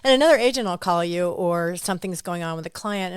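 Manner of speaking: ordinary talk, nothing marked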